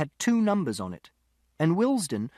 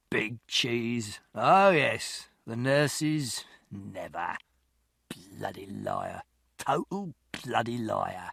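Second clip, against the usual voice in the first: put-on rambling drunk; 5 semitones lower, much slower than usual. A narrator's reading. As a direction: mumble like a muddled drunk. Drunk man voiced in story.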